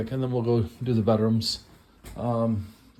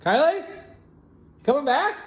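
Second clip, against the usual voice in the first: high, questioning call; 12 semitones higher, 4 dB louder, slower than usual. Pretend. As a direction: call hopefully after someone who left. Calls after the absent Kylie.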